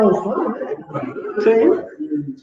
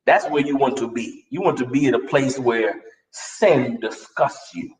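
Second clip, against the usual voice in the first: ordinary talk, nothing marked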